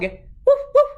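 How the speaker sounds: high yappy woof; dog bark. A narrator's reading. Witch turned dog, barking high. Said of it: "said the dog".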